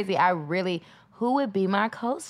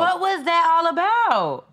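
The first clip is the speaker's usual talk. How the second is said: echoed disbelief; 9 semitones higher, 4 dB louder than usual. Retold or imitated. Echoing friend's disbelief.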